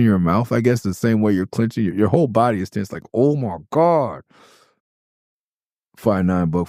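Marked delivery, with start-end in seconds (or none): 3.1-4.2 mock clenched dismay. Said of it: "same clenched voice".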